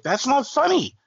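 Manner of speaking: retold mock indignation; indignant retort. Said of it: "And I was like".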